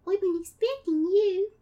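put-on high girlish voice slipping into British; accidental British accent. Hidden Sandy expecting Akira, accidentally British accent. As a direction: play Sandy with an unplanned British lilt. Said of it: "I guess I'm British now".

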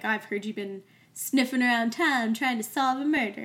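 a hint of a British copper voice; lightly done suspicious British constable; terrible British accent. Podcaster imitating the constable confronting Amanda, light British accent. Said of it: "my terrible British accent".

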